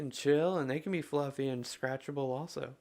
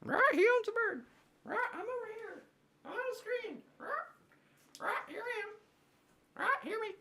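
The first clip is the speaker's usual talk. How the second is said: small, very high parrot squawk; bird voice. Acted. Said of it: "You would think that I owned a bird".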